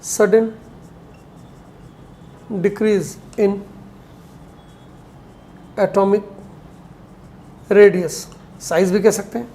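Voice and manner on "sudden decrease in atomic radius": very slow, word by word; spaced-out words for note-takers; slow dictation. Real, not acted. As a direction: dictate the definition with long gaps. Dictating a definition to write down.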